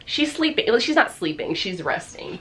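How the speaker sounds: ordinary delivery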